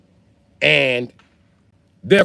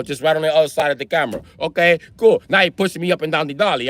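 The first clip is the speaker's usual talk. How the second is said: usual speaking voice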